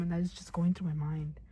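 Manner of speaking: usual speaking voice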